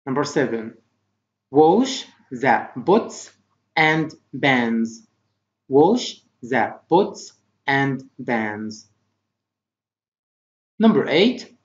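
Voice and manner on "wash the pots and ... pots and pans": very slow, separated words; slow model reading. Shown as a demonstration. Teacher reading vocabulary for pupils to repeat, slowly. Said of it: "Try to repeat after me".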